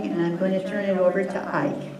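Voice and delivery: ordinary delivery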